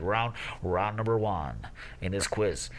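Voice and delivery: mock quiz host announcing a winner; put-on host voice; imitating the quiz host. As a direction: pass yourself off as the quizmaster. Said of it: "does it pass for being you?".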